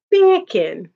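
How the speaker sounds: mock British "Beer can", R dropped; British accent. Shown as a demonstration. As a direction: say "Beer can" British so it sounds like Jamaican "bacon". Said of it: "with a British accent".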